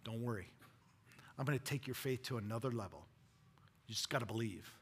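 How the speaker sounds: steady him quietly; reassuring Jesus. Retold or imitated.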